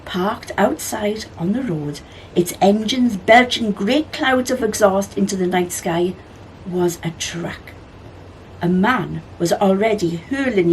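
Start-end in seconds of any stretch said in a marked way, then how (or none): none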